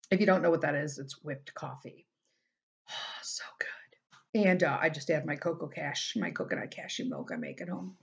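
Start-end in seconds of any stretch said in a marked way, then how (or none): 2.9-4.5 breathy whisper of pleasure; soft whispered sigh. Real, not acted. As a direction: sigh it out, savoring. Savoring whipped coffee.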